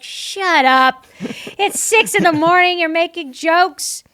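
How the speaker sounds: high, loud mock outrage; hammed-up exasperated shouting; exasperated yell. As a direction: holler at the early-morning jokers, dragging the end. Exasperated at early morning puns.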